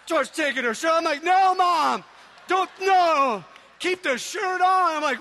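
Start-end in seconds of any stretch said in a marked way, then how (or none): 1.2-5.0 loud, high shouted protest; mock-panicked shout, drawn out at the end; retold horrified pleading. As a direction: yell like a mortified child at mom. Retold child pleading with mom.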